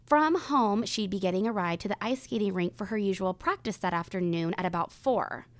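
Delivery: measured true crime storytelling; suspenseful crime show narrator. Acted. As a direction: tell the case calmly, with weight. Reads the day of disappearance.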